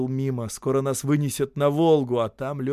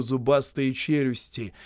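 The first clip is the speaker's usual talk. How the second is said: ordinary delivery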